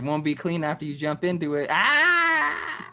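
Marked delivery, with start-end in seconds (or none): 1.7-2.9 high playful joke scream; mock scream. Teasing a friend about jumping into the water, mock scream. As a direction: yelp as if the water's gross.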